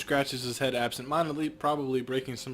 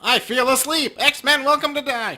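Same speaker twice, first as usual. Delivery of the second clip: higher, louder hammed-up villain yell; meme villain shout; 11 semitones higher, 9 dB louder than usual. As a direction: blurt it like a cartoon villain. GM blurts meme quote.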